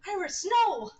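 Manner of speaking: high, loud cry of alarm; alarmed shout. Acted. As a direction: shout a warning. Character cries out in scene.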